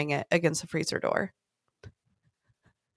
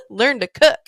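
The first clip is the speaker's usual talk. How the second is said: louder, high-pitched exasperated jab; mock scolding; 4 semitones higher, 6 dB louder than usual. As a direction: scold a useless husband, half laughing. Mock scolding an imagined husband.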